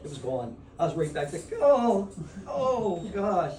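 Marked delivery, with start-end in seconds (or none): 1.6-3.5 drawn-out, high groan of dismay; pained groaning outburst. Retold or imitated. Man retelling pain flooding back to an audience, pained groan. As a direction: re-enact a long, loud 'oh' of pain.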